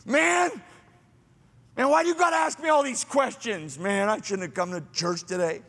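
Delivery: starts high and fast, then settles; mock complaint from the pew; resentful churchgoer griping at the pastor, high and whiny.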